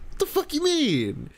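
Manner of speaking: high, indignant challenge; exasperated high-pitched demand; incredulous outrage. Real, not acted. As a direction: snap it back in disbelief, pitch jumping up. Outraged at the clip's words.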